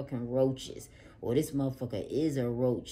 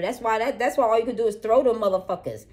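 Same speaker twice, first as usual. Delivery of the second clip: higher and louder, cussing them out; angry tirade; 6 semitones higher, 6 dB louder than usual. Acted. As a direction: tell them off with full heat. Cursing out the hidden enemy.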